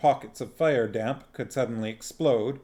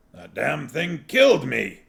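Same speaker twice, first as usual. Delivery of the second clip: louder indignant outburst; 3 semitones higher, 6 dB louder than usual. Acted. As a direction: protest it with a burst of outrage. Dwarf learns he is dead.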